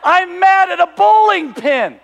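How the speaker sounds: slow, high, exasperated shout; hammed-up incredulous yell; loud disbelief. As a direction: stretch out the absurdity at full volume. Ridicules his anger at a pin.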